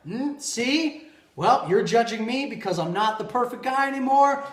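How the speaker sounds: loud, high mock-indignant retort; hammed-up wounded objection; defensive protesting. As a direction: argue back as the touchy guy. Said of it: "I'm the kind of guy who's like".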